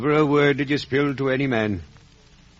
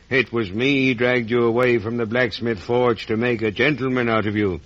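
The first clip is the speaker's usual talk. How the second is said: ordinary talk, nothing marked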